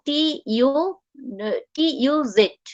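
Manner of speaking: normal talking voice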